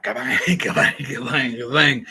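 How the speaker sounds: playful shooting sound effects; laughing mock gunfire; gun noises. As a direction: go kabang kabang like a kid firing a gun. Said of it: "just went like".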